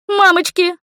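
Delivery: very high, squealed yelp; frightened squeal. A narrator's reading. Heroine ducking from fire, squealing. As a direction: squeal it in sudden panic. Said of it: "взвизгнула я и присела".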